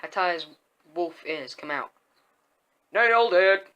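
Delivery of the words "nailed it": loud burst of triumph; triumphant yell. A narrator's reading. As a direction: yell it out like a win. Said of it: "I yell".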